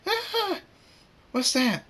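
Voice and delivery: very high startled shriek and question; shriek, then ask in alarm; frightened scream. A narrator's reading. Sweetie Belle hears an explosion go off, frightened scream. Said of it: "Sweet Belle screams".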